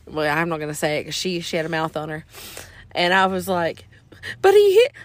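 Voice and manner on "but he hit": high, loud hammed-up whine; mock childish protest. Child pleading her case to grandma, loud mock wail. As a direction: cry out like a wronged kid.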